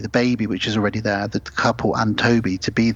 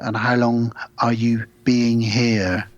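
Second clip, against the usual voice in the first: slow alien questioning; slower than usual. Retold or imitated. Alien couple asking questions.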